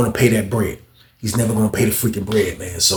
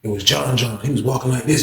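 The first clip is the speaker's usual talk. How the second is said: ordinary delivery, nothing marked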